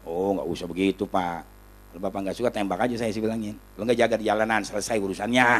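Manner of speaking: low, soft, unruffled reply; retold cool defiance. Kiai telling the commander to just shoot him, low and calm. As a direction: answer a threat quietly, keeping the voice down.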